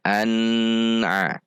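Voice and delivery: an with long held N, then a; lengthened N. Shown as a demonstration. Teacher shows wrong reading, an dragged long before a. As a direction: stretch the N of an before a. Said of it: "dibaca dipanjangkan".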